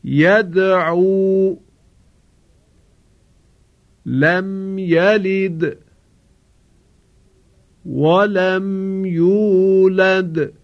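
slow tajwid, the د of لم یلد and ولم یولد bounced; recitation with qalqala. Shown as a demonstration. Teacher models the dal examples for the class. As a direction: chant slowly, echoing each dal. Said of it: "شما هم تکرار کنید".

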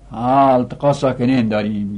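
ordinary talk

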